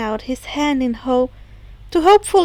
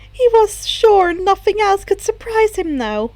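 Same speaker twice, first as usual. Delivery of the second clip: ordinary delivery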